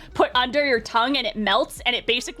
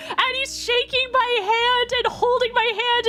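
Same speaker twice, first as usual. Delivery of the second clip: usual speaking voice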